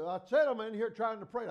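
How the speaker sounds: annoyed shout at the door; retold irritated call. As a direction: shout it at the knocking wife.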